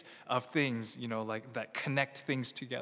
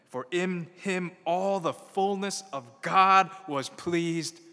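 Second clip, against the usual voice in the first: ordinary talk, nothing marked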